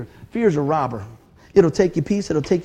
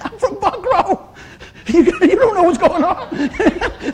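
laughing; 11 semitones higher, slower than usual. Real, not acted. Joking about his jumpy reflex.

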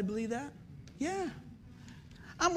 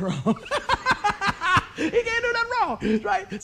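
high, loud laughing tease; words rise into a loud high laugh; 11 semitones higher, 7 dB louder, slower than usual. Real, not acted. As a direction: laugh as you rib the favourite son. Laughing mock about favourite son.

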